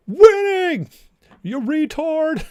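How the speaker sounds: high, cartoonish shout; mock yell. Pretend heckler yelling at the governor, hammed-up shout. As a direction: bellow it like a heckler.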